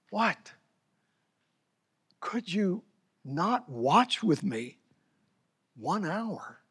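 hushed reproach to disciples; hushed whisper. Acted. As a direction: whisper it, sorrowful and soft. Jesus rebuking sleeping disciples.

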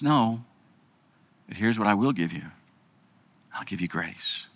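low, soft, fading; quiet divine reassurance; gentle God voice. Acted. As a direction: offer grace tenderly. God's voice answering.

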